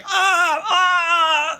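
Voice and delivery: high, drawn-out cries of pain; hammed-up screams of a struggling man; sputtering cries. Game master acts out the shot man, sputtering pained screams. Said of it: "he's, like, sputtering".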